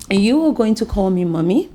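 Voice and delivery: loud, insistent command. Acted. Mother ordering daughter to call her mommy, loud and insistent. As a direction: lay down the law, no arguing. Mother asserting authority loudly.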